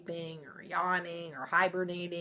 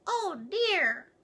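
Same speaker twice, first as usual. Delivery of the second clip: put-on much higher small baby bear; wee little voice; 13 semitones higher, 5 dB louder than usual. Baby Bear upset, high and small. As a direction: squeak it like a tiny cub. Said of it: "in his wee little voice".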